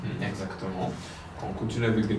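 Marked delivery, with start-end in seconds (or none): none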